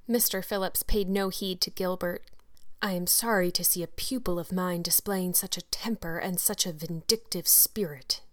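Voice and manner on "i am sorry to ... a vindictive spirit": hushed, solemn schoolmaster's reproof; lightly voiced grave disapproval. A narrator's reading. Teacher rebuking pupil before the school, solemn tone. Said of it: "he said in a solemn tone".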